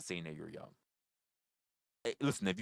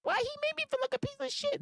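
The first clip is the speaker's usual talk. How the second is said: very high squeaky mock viewer voice; whiny caricature of a hurt fan. To viewers, parodying fans who call him serious, squeaky mock whine.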